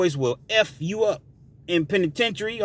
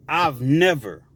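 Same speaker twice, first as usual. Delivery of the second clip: normal talking voice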